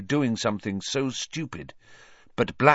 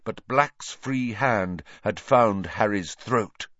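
ordinary delivery

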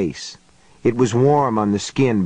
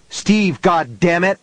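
angry call; 6 semitones higher than usual. A narrator's reading. Johnny yelling for help.

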